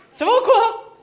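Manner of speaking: high, shouted greeting; loud call-out. Acted. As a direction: yell hello to the crowd. Calling out to the audience.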